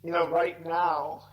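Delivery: slow, halting, choked-up pauses; fighting to stay composed, real grief; holding back tears. Real, not acted. Son of a dying mother telling the interviewer, slow and tearful. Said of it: "it's fine that I'm emotional".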